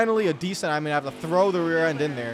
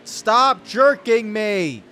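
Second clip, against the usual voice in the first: slow, drawn-out, louder plea; exasperated outburst; 6 semitones higher, 7 dB louder, much slower than usual. Real, not acted. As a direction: drag it out, fed up. Car jerks again in game.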